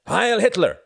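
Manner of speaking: loud, high rallying cry; cried toast. A narrator's reading. Pilot raising his stein to the restaurant, loud cry. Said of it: "cried Jorgen".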